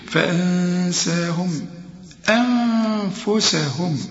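فأنساهم أنفسهم slow, with a nasal hum on the nun; ghunna and long vowel. Shown as a demonstration. Teacher demonstrating a nasal ghunna: فأنساهم أنفسهم. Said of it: "هنا في غنة".

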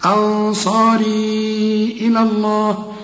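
أنصاري إلى الله recited slowly; slow recitation. Shown as a demonstration. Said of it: "والياء هنا برضه بتلغى".

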